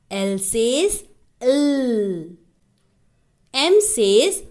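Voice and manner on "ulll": ल as a long "ulll", tongue on upper teeth; drawn-out humming ल, no final vowel; held L sound. Shown as a demonstration. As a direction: hold ल on the tongue, gliding down. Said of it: "जीभ को घुमाते हुए ऊपर वाले दांत को टच".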